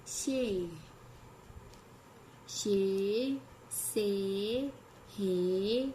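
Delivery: slow, low syllable chant for the class; chanting a Hindi syllable drill; recitation drill. Shown as a demonstration. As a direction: drill each syllable like a teacher.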